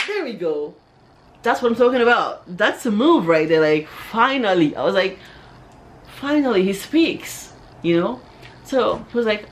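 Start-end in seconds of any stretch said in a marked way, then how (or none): 0.1-4.7 higher, louder, celebrating as if it's long awaited; acted elation. Woman celebrating inwardly that her crush spoke, excited. Acting out her triumphant inner reaction. 6.2-7.5 high, loud, slow exclamation; acted elation. Woman marvelling that her crush spoke, excited.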